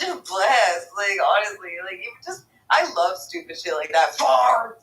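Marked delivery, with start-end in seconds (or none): 4.2-4.7 mild mock shout. Repeating the catchphrase.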